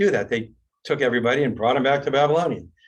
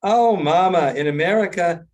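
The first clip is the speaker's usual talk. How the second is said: higher, louder mock protest; exasperated son; 6 semitones higher, 5 dB louder than usual. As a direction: plead with mama in mild exasperation. Joke, son fends off mother.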